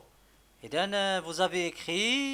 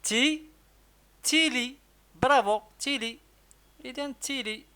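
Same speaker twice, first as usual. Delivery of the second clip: تي and تيلي said much higher and louder; high cheerful sounding out; 9 semitones higher, 4 dB louder, faster than usual. Shown as a demonstration. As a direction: praise brightly while sounding out تيلي. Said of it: "نقدو نكتبو شنو". (نطق تيلي بصوت عالٍ ومرتفع)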